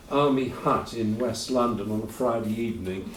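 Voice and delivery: ordinary delivery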